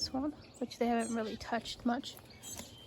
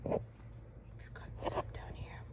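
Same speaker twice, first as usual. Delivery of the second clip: wildlife watching whisper; 11 dB quieter than usual. Real, not acted. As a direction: hush so the birds stay. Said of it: "I'm whispering so I don't scare them".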